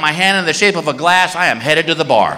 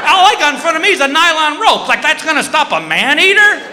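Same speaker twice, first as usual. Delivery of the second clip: high-pitched comic alarm; panicked rant; 10 semitones higher than usual. Retold or imitated. Snorkeler with only a rope against a shark, panicked.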